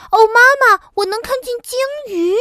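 put-on very high, loud child's squeal; high-pitched, excited cub voice; thrilled little bear. A narrator's reading. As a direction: shout it like a cub spotting something amazing. Little bear thrilled to see whales.

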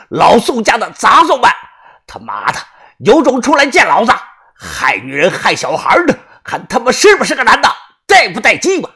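high, loud enraged roar; furious shouting. A narrator's reading. Old master dares the Song brothers out, furious shouting. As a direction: challenge hidden enemies at full voice. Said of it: "怒声地喝道".

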